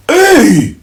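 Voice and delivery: indignant hey. Retold or imitated. Acted quarrel, girlfriend exclaiming.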